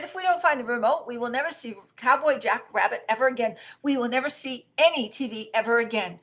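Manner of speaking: high, loud, escalated tantrum; frantic hollering. Acted. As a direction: holler it like the world is ending. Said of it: "hollered Rabbit".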